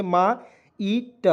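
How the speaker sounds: "मा ईट" drawn out very slowly as two separate parts, मा then ईट; split syllables. Shown as a demonstration. Teacher sounding out the word for students, very slow split syllables. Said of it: "म में आ की मात्रा जोड़ेंगे".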